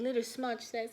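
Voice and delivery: plain reading voice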